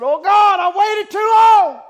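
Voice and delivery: high, loud, pleading cry to God. Retold or imitated. Young man cries to God for salvation, loud pleading. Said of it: "And he cried out".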